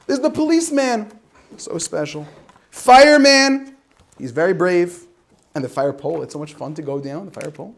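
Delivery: bright storybook read-aloud; reading to children. Acted. Reading the helpers book aloud, storybook style. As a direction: read like to a class of kids.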